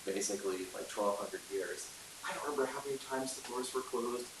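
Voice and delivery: ordinary talk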